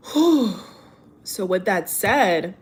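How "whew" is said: a long, loud, high exhale to let the emotion out; relieved whew. Real, not acted. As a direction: breathe it all out in relief. Exhale after emotional talk.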